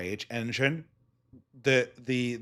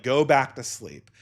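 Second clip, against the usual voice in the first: ordinary delivery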